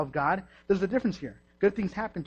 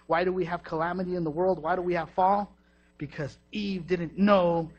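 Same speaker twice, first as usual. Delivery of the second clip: ordinary delivery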